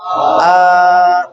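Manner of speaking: a long, held आ sound; drawn-out vowel. Shown as a demonstration. Teacher shows the khadi zabar sound. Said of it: "कैसे पढ़ेंगे?".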